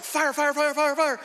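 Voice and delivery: high, urgent burst of repeated words; rapid-fire mimicry. Storyteller mimicking missiles shooting into the garage, high rapid-fire mimicry.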